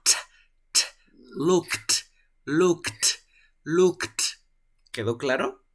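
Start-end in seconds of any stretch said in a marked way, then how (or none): 0.0-0.8 a bare "t" puffed out without voice; aspirated T. Shown as a demonstration. Teacher showing tongue movement, "t" as a sharp puff. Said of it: "baja y bota aire". 1.4-4.2 "looked" as lookt, louder with a puffed T; aspirated final T. Shown as a demonstration. Teacher drilling the T, each "looked" ending in released air. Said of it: "baja y bota aire".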